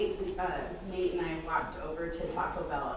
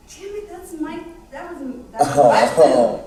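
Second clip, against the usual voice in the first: mock exasperation; 8 semitones higher, 15 dB louder than usual. Retold outrage over forgotten tacos.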